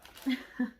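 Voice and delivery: brief, breathy real laugh; a quick chuckle, slightly high; short laugh. Real, not acted. Vlogger delighted by her new nail gel, short laugh.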